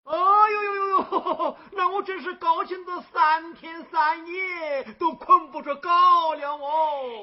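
loud, high cackling delight through the words; overjoyed laughing. Acted. Old man laughing with joy as a girl calls him godfather. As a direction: laugh it out, beside himself with joy.

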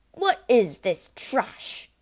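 slow, loud, scornful growl; father scorning his daughter's book; contemptuous demand. A narrator's reading. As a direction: drag it out with disgust. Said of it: "he said, snatching the book".

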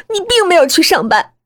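high, loud accusing burst; angry accusation. Acted. Daughter exposes mother's absence from work, angrily accusing. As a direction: confront her with the lie, pitched high.

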